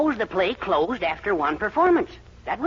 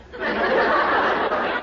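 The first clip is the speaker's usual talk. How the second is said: big laugh; 4 dB louder than usual. Real, not acted. Anderson laughs at impression.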